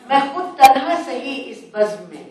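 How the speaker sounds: phrase by phrase to an audience, with pauses; couplet recitation. Acted. एक शेर आपको सुना दूं.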